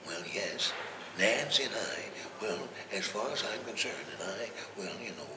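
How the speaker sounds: low, quiet put-on statesman's voice; Ronald Reagan. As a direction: do a soft-spoken, folksy president full of hesitant "well"s. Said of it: "I had his voice".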